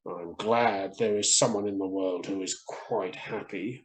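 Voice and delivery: muttered disappointment. Acted. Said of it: "muttered a disappointed man".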